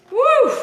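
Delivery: a loud, high whoop at the heat; high loud woo. Real, not acted. Cook whooping at the jalapeno's heat, loud high cry. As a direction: whoop like the spice just hit you. Reacting to the spicy pepper.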